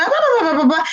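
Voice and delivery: high-pitched mock baby babble; toddler babble. Shown as a demonstration. Therapist imitates a toddler still babbling past one, high mock babble. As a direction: chatter like a toddler telling a story. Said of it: "sigue balbuceando".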